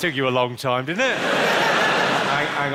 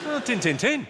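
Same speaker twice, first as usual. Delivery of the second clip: Yorkshire accent; 7 semitones higher than usual. Shown as a demonstration. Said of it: "My favourite Yorkshire phrase".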